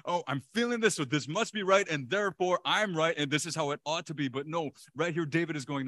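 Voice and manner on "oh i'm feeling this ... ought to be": loud, high, hammed-up insistence; bratty child. Preacher mocks our self-centred thinking, as an insistent bratty child. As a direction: insist like a child who must be right. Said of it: "bratty children, we're, we're thinking".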